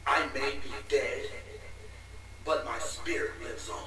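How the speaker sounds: deep villain voice. Acted. Dying villain speech.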